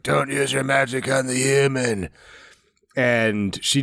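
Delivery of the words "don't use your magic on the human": put-on superhero voice; Batman voice. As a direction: do a mock Batman growl. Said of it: "in her Batman voice".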